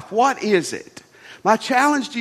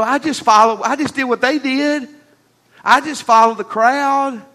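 mock excuse; 4 semitones higher, 4 dB louder than usual. Mock excuse before God.

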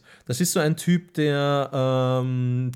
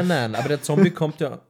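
usual speaking voice

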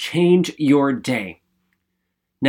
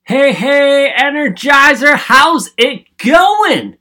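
much higher and louder, the last words stretched slow; hyped greeting; 9 semitones higher, 8 dB louder, much slower than usual. Real, not acted. Host greets the Energizers with a hyped shout. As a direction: burst in with big energy.

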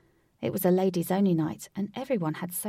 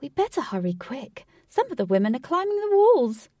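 rising to a high, loud panic; frantic and getting louder; desperate alarm; 7 semitones higher, 7 dB louder than usual. A narrator's reading. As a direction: urge them to hurry. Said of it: "Jose said desperately".

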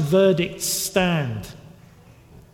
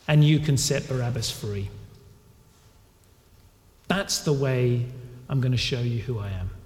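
softer, quiet acceptance; calm surrender; 4 semitones lower, 3 dB quieter than usual. Retold or imitated. Jesus accepting the cross, quiet and calm. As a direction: gently give way. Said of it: "And Jesus says".

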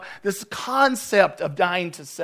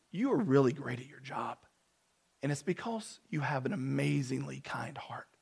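softer, down to a near whisper; hushed praise; 6 semitones lower, 10 dB quieter than usual. Retold or imitated. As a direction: lean in and say it quietly, like a secret compliment. Telling the worker he's kind.

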